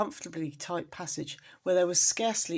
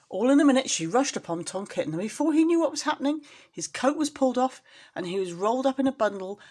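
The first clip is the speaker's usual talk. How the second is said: rushing narration; 7 semitones higher, 5 dB louder than usual. A narrator's reading. Sudden rush of the rats.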